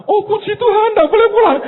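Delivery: very high, loud exaggerated relief; very high, loud mock praise. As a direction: squeal out a mock-pious thanks. Mocking relieved child.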